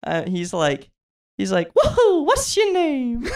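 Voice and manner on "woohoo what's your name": very high, drawn-out cartoon greeting; slow falsetto whoop and question, game-mascot style; Charles Martinet's Mario. Imitating the voice actor greeting a fan, high Mario voice. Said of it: "he would only use that voice".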